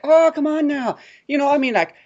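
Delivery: lightly hammed-up broken English pitch; a salesman pushing a timeshare in broken English. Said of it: "talked in, like, broken English".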